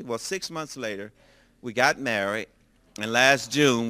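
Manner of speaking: ordinary delivery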